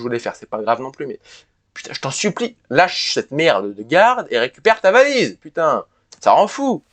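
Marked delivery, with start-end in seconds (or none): none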